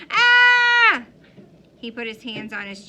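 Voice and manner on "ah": a short shrill shriek, much higher and louder; mock scream. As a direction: show off the scream like a kid. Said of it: "It was a".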